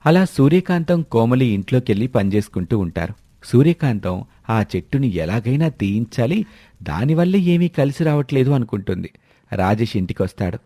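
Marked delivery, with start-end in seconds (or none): none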